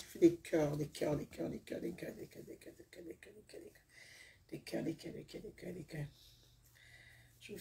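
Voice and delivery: repeated words dwindling into a whisper; low murmur fading into barely audible whispering. Real, not acted. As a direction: trail away softly until almost no voice is left. Sending hearts, fading into whisper.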